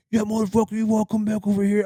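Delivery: high-pitched imitation of a raging man; high, agitated mock yell; mock angry yelling. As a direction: mimic a worked-up guy hollering a challenge. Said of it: "I hear him yelling".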